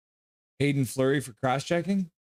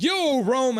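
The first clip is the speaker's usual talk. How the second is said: "Yo, Rome" pitched way up, bright call-out; mock hype greeting; 12 semitones higher than usual. Reading a viewer's greeting, high called out. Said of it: "Minor Dad's like".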